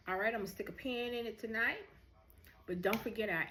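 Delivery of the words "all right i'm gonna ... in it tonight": usual speaking voice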